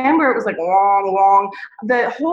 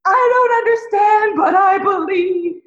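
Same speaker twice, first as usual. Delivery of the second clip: higher and louder, breaking into a cry; tearful confession; 7 semitones higher, 4 dB louder than usual. Retold or imitated. Retelling her conversion, tearful higher-pitched confession. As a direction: confess it through tears, slowing at the end. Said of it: "I remember saying".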